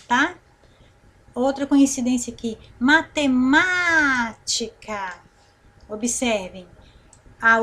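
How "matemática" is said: slow "matemática" with a long, strong MÁ; stretched MÁ. Shown as a demonstration. Teacher points out another accented word, MÁ stretched. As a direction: hold the MÁ in "matemática". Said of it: "E o ma já foi acentuado".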